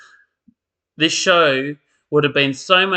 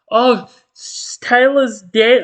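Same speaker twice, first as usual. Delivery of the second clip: mock shocked gasp; 9 semitones higher, 7 dB louder, slower than usual.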